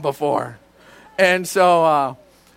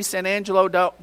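usual speaking voice

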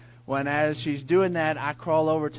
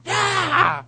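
sudden shout, much higher and louder; scare shout; 12 semitones higher, 7 dB louder than usual. Retold or imitated. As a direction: jump out and yell to scare her. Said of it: "And I screamed at her".

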